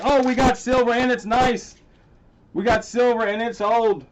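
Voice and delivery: genuinely excited find. Real, not acted. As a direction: burst out with delight over the coins. Finds old silver coins.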